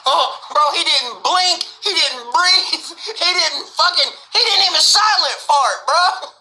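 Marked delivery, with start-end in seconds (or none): none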